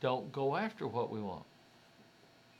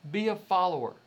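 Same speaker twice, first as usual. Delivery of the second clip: loud, raised mock command; imitated stern orders; 4 semitones higher, 6 dB louder than usual. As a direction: order it as society would. Voices society's orders to conform.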